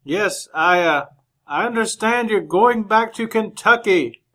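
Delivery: put-on half-shouted small talk to a deaf old man; loud. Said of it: "Just talk good and loud".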